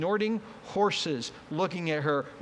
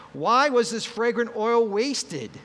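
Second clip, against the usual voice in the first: louder, slowed, indignant question; indignant demand; 5 semitones higher, 6 dB louder, slower than usual. Acted. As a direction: scold her for wasting it. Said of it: "they criticized her sharply".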